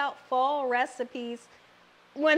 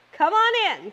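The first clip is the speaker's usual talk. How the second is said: calling out; 6 semitones higher, 6 dB louder than usual. Real, not acted. Inviting viewers to the food.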